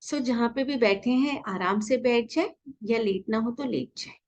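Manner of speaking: calm guided meditation voice, unhurried. Acted. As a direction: ease them gently into stillness.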